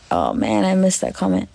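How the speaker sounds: ordinary talk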